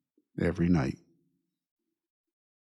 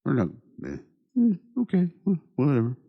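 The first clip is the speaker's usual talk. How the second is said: 1 dismissive shrug; 8 semitones higher, faster than usual. Retold or imitated. Mimicking dismissive people.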